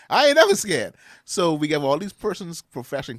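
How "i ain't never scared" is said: high, loud, swaggering boast; cocky imitated boast; defiant brag. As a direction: toss it out like nothing can touch you. Quoting defiant Apostle Sanders.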